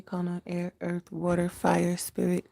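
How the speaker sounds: ordinary delivery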